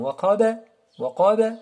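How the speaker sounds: "Waqaba" with a bounced, echoed b; qalqala. Shown as a demonstration. Teacher shows the vibration, bouncing "waqaba". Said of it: "la vibration".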